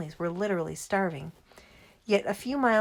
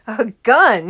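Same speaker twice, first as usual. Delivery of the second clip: loud, higher, scornful exclamation; disgusted cry; 4 semitones higher, 15 dB louder than usual. A narrator's reading. Father scorns the idea of poaching with a gun, disgusted cry. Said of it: "he cried, disgusted".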